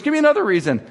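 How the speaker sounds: high-pitched mock mom demand; acted exasperated mom; demanding mother. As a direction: challenge the son as his mother. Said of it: "then she goes".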